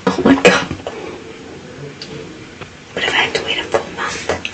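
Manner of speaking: soft anxious whisper; hushed worried question. Real, not acted. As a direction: whisper the worry under the breath. Keeps whispering to friend.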